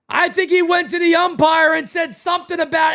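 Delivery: normal talking voice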